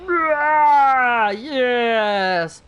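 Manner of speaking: loud, triumphant battle cry; triumphant shout. Real, not acted. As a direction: let it burst out like a victory.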